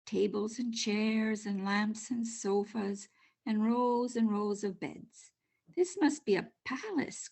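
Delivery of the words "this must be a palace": awed gasp. A narrator's reading. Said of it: "Corduroy gasped".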